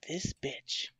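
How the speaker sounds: unvoiced-sounding whisper under the breath; hushed, disbelieving aside; muttered aside. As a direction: mutter it privately, barely voiced. Retold private reaction to rude customer.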